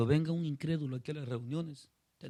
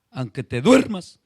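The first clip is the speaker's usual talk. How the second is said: en voz alta; 11 dB louder, slower than usual. Real, not acted. Preacher rouses dozing listener.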